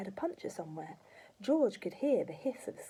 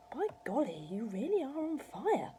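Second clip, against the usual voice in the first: amazed; 6 semitones higher than usual. A narrator's reading. Boy amazed at smoke.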